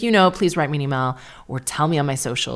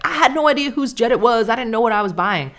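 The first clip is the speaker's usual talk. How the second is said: defensive quote; 6 semitones higher, 4 dB louder, faster than usual. Retold or imitated. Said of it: "was like".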